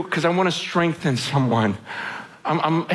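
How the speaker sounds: ordinary talk, nothing marked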